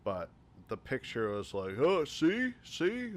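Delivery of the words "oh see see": high, loud taunting "see? see?"; mocking see-see. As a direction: tease like a smug know-it-all. Picture of Scheider shown in movie.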